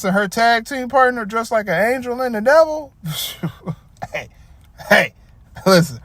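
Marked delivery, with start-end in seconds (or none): none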